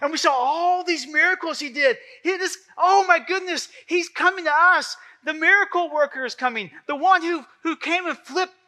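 excited crowd. Acted. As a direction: gush like fans awaiting the miracle worker. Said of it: "And everyone's so excited".

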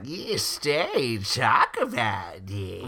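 lightly voiced witchy old lady; sinister old lady. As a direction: coax her like a menacing grandma. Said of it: "the old lady's like".

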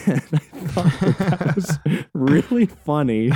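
words broken by helpless chuckles; laughing. Real, not acted. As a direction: crack up while saying it. Cracks up at own story.

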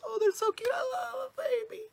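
squeaky, very high gush; mock baby-cooing. Imitating someone seeing little babies, high cooing. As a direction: coo way up high, hammed-up. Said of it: "when you see little babies".

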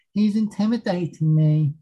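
put-on high, whiny Spanish-accented complaint; imitating a driver in a Spanish accent; mock Spanish accent. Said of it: "in his Spanish accent".

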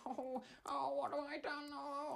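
high, panicky whimpering voice; nervous Edmund. Retold or imitated. Edmund panicking to his friend after hitting a cow, high nervous voice. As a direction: play a frightened, fretting man. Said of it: "he got really nervous. He went".

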